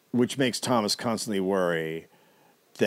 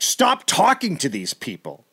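furious order; 7 semitones higher, 5 dB louder than usual. Retold or imitated. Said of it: "Moore is just fucking furious".

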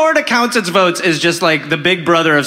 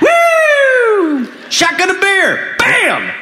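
rowdy shout; 14 semitones higher, much slower than usual. Acted. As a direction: whoop like a drunk brother. Rowdy brother cheering.

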